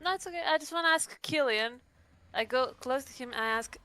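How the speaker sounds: ordinary talk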